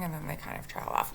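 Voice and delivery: fading to a whisper; trailing off. Shown as a demonstration. Teacher imitating a student who trails off, fading whisper. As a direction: let the sentence die away, breathy and soft. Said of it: "to not trail off at the end of sentences".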